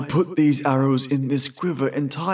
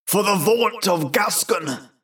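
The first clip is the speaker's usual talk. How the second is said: high, slow knightly declamation; crying out; 5 semitones higher, 3 dB louder, slower than usual. A narrator's reading. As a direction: proclaim it over a fallen foe. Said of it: "cried Sir James".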